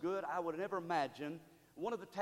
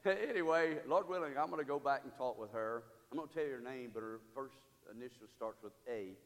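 usual speaking voice

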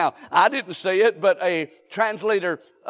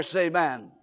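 normal talking voice

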